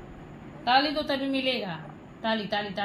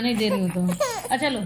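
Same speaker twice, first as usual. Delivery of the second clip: usual speaking voice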